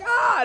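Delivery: high loud shout of triumph; high triumphant ah; joyful yelp. Retold or imitated. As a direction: burst out in delight. Shout of joy on winning.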